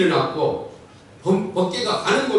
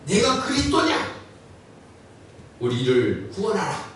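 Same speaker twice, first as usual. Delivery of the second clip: mocking; 3 semitones higher than usual. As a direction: imitate a taunt, pitched up then falling. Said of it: "조롱했습니다". (조롱하듯 흉내 내어)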